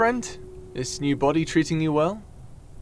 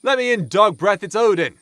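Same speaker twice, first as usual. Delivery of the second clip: much higher, louder, bossy shout at the gate; loud demand; 7 semitones higher, 5 dB louder than usual. Acted. Odin yelling at the castle guard to open up, loud demand. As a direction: bark it at the guard from outside.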